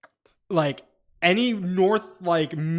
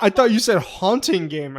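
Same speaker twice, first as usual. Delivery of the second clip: ordinary talk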